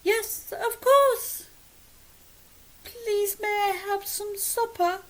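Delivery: very high, squeaky little voice; squeaking. Acted. Child answering mum after a fright, very high squeak. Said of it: "and squeaking".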